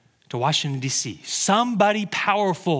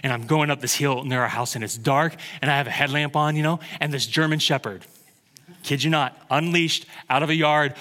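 normal talking voice

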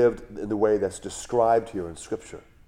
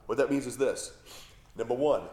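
ordinary talk

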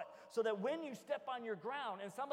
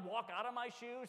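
ordinary delivery

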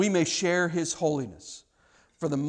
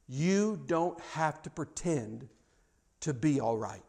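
soft earnest; 3 semitones lower, 8 dB quieter, slower than usual. Real, not acted. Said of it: "hear me".

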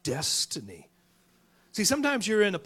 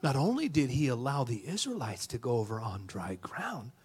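whispered, like a secret revealed; hushed whisper; 4 semitones lower, 4 dB quieter than usual. Retold or imitated. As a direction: lean in and whisper the punchline. Kid tells the punchline.

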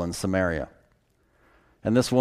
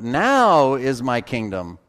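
"now" stretched long and pitched up; stress on now; slower than usual. Shown as a demonstration. Preacher points out the key word, "now" drawn out and stressed. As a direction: lean hard and slowly on "now". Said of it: "here's the key word you gotta get".

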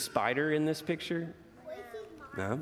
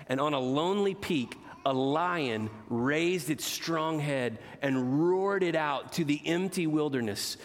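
put-on expressive read-aloud to children; gentle storyteller voice for kids; storybook reading; 4 semitones higher, slower than usual. A narrator's reading. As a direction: read it like a bedtime picture book. Reads picture book aloud.

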